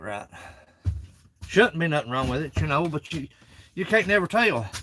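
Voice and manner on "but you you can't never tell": usual speaking voice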